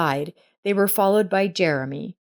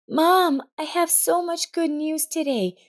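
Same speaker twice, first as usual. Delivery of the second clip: put-on excited little girl; 11 semitones higher than usual. A narrator's reading. Girl greeting her mother.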